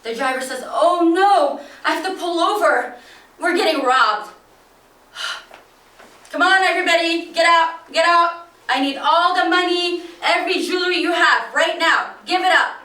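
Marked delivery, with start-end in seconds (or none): 0.7-4.3 alarmed driver. Retold or imitated. Driver panics at gunman. 6.3-12.8 loud, high, fast barked orders; armed robber yelling at passengers to get out; robber's loud commands. Retold or imitated.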